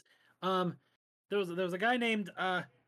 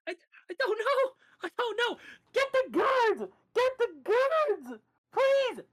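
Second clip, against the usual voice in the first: high, loud, panicked screaming for help; terrified shriek; 8 dB louder, slower than usual. Acted. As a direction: shriek for the guards in terror. Knife against his chest.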